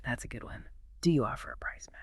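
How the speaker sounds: soft unvoiced whisper; whispering the phrase back to the co-host. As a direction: breathe the words without voice. Sudden whispered repeat.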